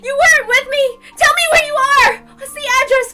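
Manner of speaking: high, loud, crying demands; sobbing shouts. Acted. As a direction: scream through tears. Shion sobbing, demanding answers.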